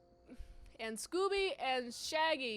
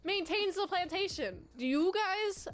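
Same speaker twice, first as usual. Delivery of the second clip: incredulous question; 6 semitones higher, 4 dB louder than usual. Retold or imitated. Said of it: "And I'm like".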